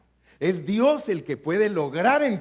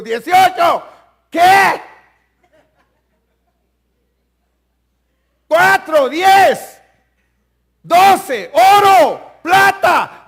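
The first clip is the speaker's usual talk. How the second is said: mock shout, much higher and louder; shouting numbers; 13 semitones higher, 16 dB louder, much slower than usual. Pastor shouting verse numbers at a confused member, mock shout. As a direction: bellow the numbers at someone who doesn't get it. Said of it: "imagínese, yo le digo".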